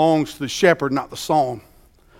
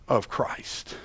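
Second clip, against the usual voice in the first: hushed; 4 semitones lower, 6 dB quieter, slower than usual. Acted. Preacher drops voice to hush.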